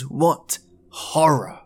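plain reading voice

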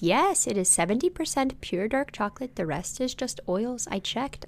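soft, careful put-on mom voice; imitated reassuring mom. Mom reassures her allergic daughter, soft put-on voice. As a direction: imitate a mother softly insisting.

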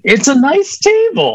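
fussy wife defending her table; squeaky jealous wife; high mock wife voice.

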